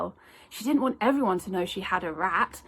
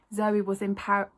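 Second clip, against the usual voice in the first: usual speaking voice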